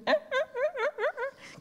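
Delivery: very high excited whimpering; excited dog whine. Retold or imitated. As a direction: squeak like a little dog hearing its owner. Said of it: "'cause she was so excited".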